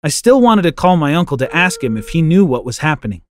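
usual speaking voice

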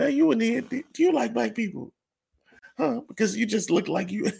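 high mock prankster asking strangers. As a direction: squeak it like the goofy prankster. Imitating prankster's voice.

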